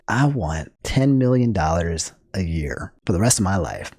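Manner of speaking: low, soft quoted movie line; a lightly acted film villain, voice dropped low; favorite movie line. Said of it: "One of my favorite lines in all movie lines".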